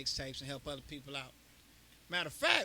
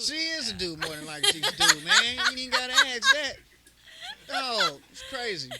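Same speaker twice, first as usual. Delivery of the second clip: much higher and louder, laughing through words; laughing hard; 14 semitones higher, 5 dB louder than usual. Real, not acted. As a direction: burst out laughing mid-sentence. Laughing at his own joke.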